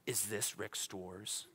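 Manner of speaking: slow, low, hushed telemarketer impression; soft, drawn-out mock phone-caller voice. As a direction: imitate a caller slowly checking a name. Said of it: "telemarketers call me and they're like".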